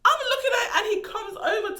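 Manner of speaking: can't get the words out for laughing; laughing through words. Real, not acted. Telling viewers how he came round to her door, laughing.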